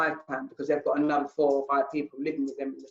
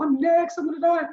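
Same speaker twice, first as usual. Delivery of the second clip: panicking; 13 semitones higher, 4 dB louder than usual. Retold or imitated. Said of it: "So I started panicking".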